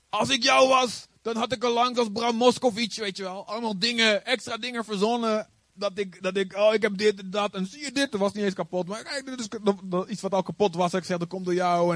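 high, thin mock voice of the driver; lightly squeaky mock complainer; imitating the man. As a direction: play him as the man who would have made a fuss. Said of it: "Hij zei".

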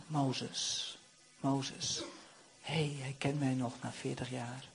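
very low, soft call, then quiet wonder; soft, awed near-murmur; hushed calling. Acted. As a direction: call gently from afar, then marvel under your breath. God calls Moses; Moses softly wonders.

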